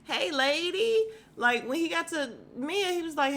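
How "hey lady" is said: high, loud, drawn-out hello; happy greeting. Retold or imitated. Peter happier to see Karen, delighted imitated greeting. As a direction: greet her with delight, voice lifted. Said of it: "He was happier to see Karen".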